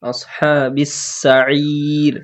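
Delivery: very slow, drawn-out Quranic reading; slow recitation. Shown as a demonstration. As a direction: hold each sound of আসহাবিস সাঈর. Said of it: "সিন হারাকাত তাড়াতাড়ি পরে আইন র". (আসহাবিস সাঈর ধীরে টেনে)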